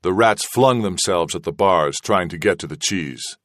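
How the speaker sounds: ordinary delivery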